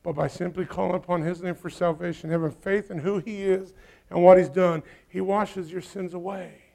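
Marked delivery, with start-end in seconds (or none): none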